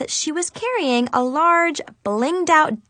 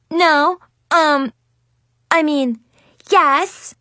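higher, louder flustered stammer; 5 semitones higher, 5 dB louder, much slower than usual. A narrator's reading. Little sister caught out by her big sister, stammering. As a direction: trip over the words in a panic. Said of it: "Amanda stammered".

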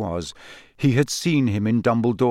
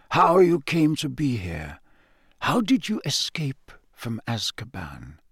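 measured headmaster's voice, easing softer at the end; gently questioning; 2 semitones higher than usual. A narrator's reading. Headmaster questioning the prisoner about his escape, soft and measured. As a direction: probe carefully without pressing. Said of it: "said Dumbledore softly".

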